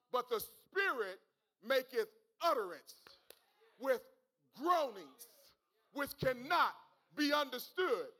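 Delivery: loud, insistent pulpit delivery; preacher building to a near shout; full-force preaching. Real, not acted. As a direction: press it home to the congregation.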